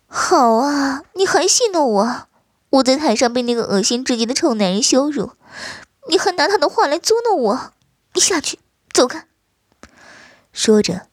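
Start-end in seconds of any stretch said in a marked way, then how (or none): 0.0-9.2 furious at being teased; much higher sharp protest; indignant wife. Acted. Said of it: "嗔道".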